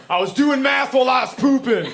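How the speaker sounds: high, indignant mock shout; aggressive yell. Embarrassed son yells at dad through bathroom excuse, aggressively. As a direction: yell it accusingly through a door. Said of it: "he finally yells through the door".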